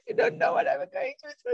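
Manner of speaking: high, tearful mock wail; imitating crying lady. Lady crying to her mentor, imitated tearful high wail. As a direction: play the sobbing client pouring out her pain. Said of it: "they start crying".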